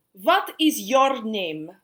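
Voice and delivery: dance teacher voice. A narrator's reading. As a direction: sweep the room with a grand call. Teacher asks Ron.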